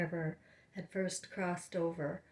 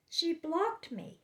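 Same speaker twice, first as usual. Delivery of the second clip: indignant disbelief; 10 semitones higher, slower than usual. Real, not acted. Indignant report of being blocked.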